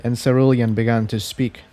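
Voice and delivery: ordinary delivery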